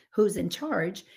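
normal talking voice

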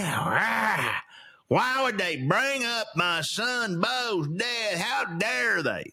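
slow, whiny mock rant; hammed-up old man complaining slowly; mock angry Joe. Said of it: "he's screaming, he's frowning".

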